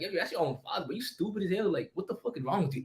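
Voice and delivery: hushed inner voice of regret. Retold or imitated. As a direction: mutter it to yourself, full of regret. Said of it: "In my head, I was talking".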